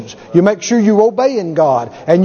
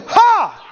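higher, sharp defiant laugh-shout; 8 semitones higher than usual. Acted. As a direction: bark a scornful ha at the devil. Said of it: "you say".

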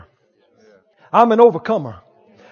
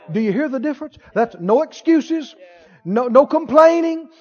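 ordinary delivery

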